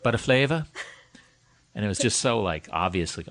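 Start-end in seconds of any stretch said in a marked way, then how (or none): 0.0-0.7 put-on Jamaican accent. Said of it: "like a Jamaican accent".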